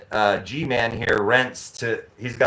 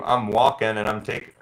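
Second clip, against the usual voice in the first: normal talking voice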